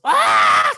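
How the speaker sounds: short, very high, loud scream of fright; fainting scream. Acted. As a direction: shriek at the sight of blood. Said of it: "It sees the blood, goes".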